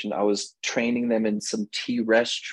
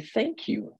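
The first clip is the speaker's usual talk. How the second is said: a much higher, softer, mock-sweet "Thank you"; 11 semitones higher, 5 dB quieter than usual. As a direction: coo it gratefully, half teasing. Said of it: "I've learned to say".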